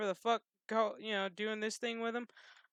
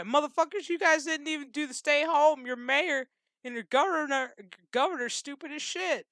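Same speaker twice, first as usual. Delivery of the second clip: louder and higher, exasperated; angry rant; 8 semitones higher, 6 dB louder than usual. Real, not acted. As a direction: chew them out, fed up. Ranting at Iowans.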